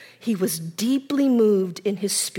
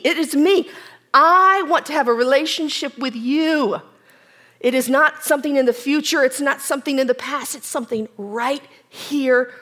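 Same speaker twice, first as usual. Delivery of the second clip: louder, urgent appeal; passionate plea; 2 semitones higher, 4 dB louder than usual. Acted. Jesus pressing Martha with a fervent, louder appeal. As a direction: press the truth home with fervor.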